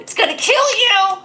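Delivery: high, loud puppet attack cry; hammed-up puppet menace, shouted high; menacing puppet. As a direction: squeal a mock threat as the puppet. Hand puppet playfully threatens viewers.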